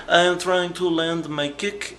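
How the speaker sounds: high, slow, dopey fighter voice; imitating the fighter. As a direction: whine it slowly like a clueless fighter. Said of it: "And he's like".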